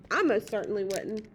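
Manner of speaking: plain reading voice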